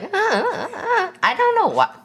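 very high, cracking puberty voice; pubescent teen. Shown as a demonstration. Host showing how their voice turns, squeaky puberty crack. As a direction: squeak like a thirteen-year-old whose voice is breaking. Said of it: "like, a puberty thirteen-year-old".

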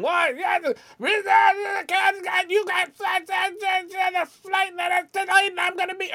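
high-pitched garbled rant; mock yelling. Irate passenger yelling at airline lady over lightning, high mock yell. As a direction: yell in a strained high voice, dragging words out. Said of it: "yelling at the lady saying".

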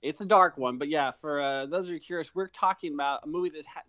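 normal talking voice

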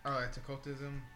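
normal talking voice

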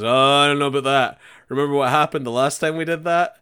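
loud, doubting objection; loud doubtful quote. Retold or imitated. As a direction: raise a worried objection. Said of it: "the guy goes".

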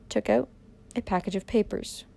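usual speaking voice

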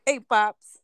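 punchy New York mafia greeting; New York mafia accent. Retold or imitated. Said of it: "mafia in New York".

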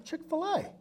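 retold high, eager reply; high-pitched obvious answer. Answering his friend who asked help who, high-pitched. Pitching himself to a huge company.

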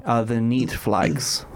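Netflix in a mock Russian accent, slow; fake Russian accent. Mock Russian accent recommending a show to friends. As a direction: drawl "the Neat Flags" as a bad Russian. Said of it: "I don't know what accent that was".

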